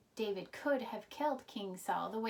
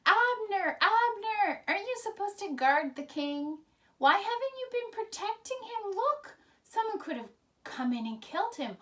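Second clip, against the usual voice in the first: high, loud calling out across a valley; yelling down the hill; 11 semitones higher, 7 dB louder than usual. Acted. David's men calling down to Abner, high loud yell. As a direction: shout up high to wake the camp below. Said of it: "And they started yelling".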